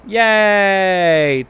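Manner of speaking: mock yay, loud and bright; sarcastic cheer. Real, not acted. GM mocking the technical drama with loud cheer. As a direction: cheer loudly, tongue in cheek.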